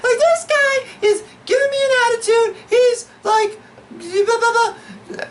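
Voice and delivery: very high whiny falsetto; hammed-up falsetto complaint trailing into babble; mock complaining woman. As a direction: imitate a lady tattling in squeaky falsetto. Bar lady complaining to owner.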